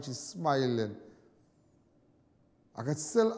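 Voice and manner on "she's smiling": ordinary talk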